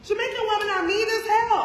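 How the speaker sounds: high, loud, vindicated exclamation; indignant outburst. Acted. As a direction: exclaim it loudly as proof you were right. Acts out vindicated, indignant reaction.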